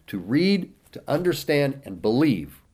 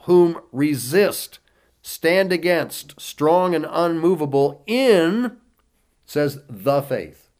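fiery preaching; 4 semitones higher, 6 dB louder than usual. Acted. Preacher urging believers to resist the devil, forceful. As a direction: hammer the verse home.

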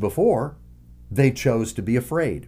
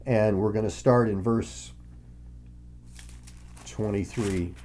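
normal talking voice

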